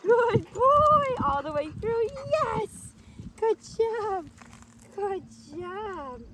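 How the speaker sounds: over-the-top praise as the dog runs in; party praise. Shown as a demonstration. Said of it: "throw a party and lots of praise".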